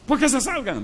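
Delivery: réplica aguda y cortante; échalos con rabia; furioso. Retold or imitated. Enfermo furioso echa a la familia. (el enfermo echa a su familia, furioso)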